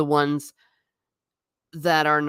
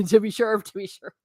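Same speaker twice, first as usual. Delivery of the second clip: mock Irish accent, fading out; lightly hammed Irish brogue; 4 semitones higher than usual. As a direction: stock Irish "to be sure". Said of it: "they really do talk like that".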